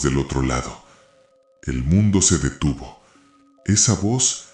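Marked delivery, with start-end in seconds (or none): none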